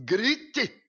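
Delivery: high, loud command with a laugh; a father laughing as he orders his son to shout; urging shout. Retold or imitated. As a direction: shout it at him, laughing, egging him on.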